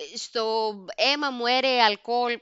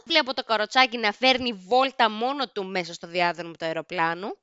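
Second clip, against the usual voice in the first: usual speaking voice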